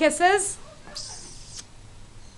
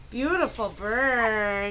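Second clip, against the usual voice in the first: ordinary delivery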